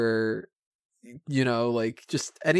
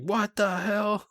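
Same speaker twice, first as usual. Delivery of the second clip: high, slow and stunned; drawn-out disbelief; baffled; 9 semitones higher, much slower than usual. Retold or imitated. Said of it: "you're just like".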